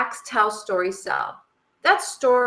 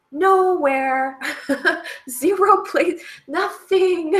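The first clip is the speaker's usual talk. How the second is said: laughing disbelief; 8 semitones higher, 6 dB louder, much slower than usual. Real, not acted. Mocking the bank ad's lack of banking.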